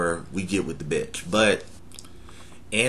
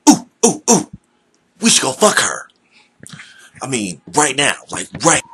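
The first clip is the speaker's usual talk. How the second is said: high, louder ooh and urging; eager urge voice; 4 semitones higher, 6 dB louder, faster than usual. Acted. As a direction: whoop and push for it now. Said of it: "a conversation with ourselves".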